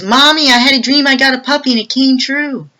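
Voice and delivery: put-on be a thrilled little girl. Youngest daughter tells mom, excited child voice.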